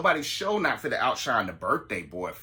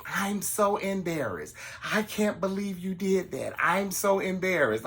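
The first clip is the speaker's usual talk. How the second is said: higher, drawn-out hurt whine; mock embarrassed whine; 7 semitones higher than usual. Date complaining to host after being yelled at, mock whine. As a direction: play the wounded date, a touch overdone. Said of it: "here he go tell me".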